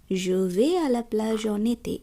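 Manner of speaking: en été run together as one word, slowly; linked en été. Shown as a demonstration. Teacher shows learners the en été liaison. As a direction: glide en été into one sound. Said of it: "en été এই শব্দ দুটি একত্রে".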